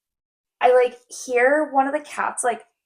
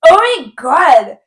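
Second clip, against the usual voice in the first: loud slow mock horror; shocked; 4 semitones higher, 9 dB louder, slower than usual. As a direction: drag out the shock, louder. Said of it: "You're like".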